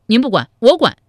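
high, loud, emphatic comeback; forceful retort. Acted. One sworn brother overruling the other, loud and forceful. Acted quarrel, cutting in forcefully.

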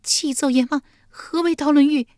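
high, loud, frightened woman; anxious high-pitched question; trembling with fear. A narrator's reading. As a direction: plead fearfully to the judge. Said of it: "玉莲战战兢兢地问道".

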